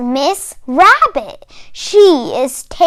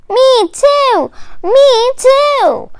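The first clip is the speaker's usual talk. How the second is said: calling out; 10 semitones higher than usual. A narrator's reading. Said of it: "call the others".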